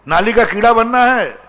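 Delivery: high, loud rhetorical challenge; challenging question. Real, not acted. Preacher loudly questioning the congregation.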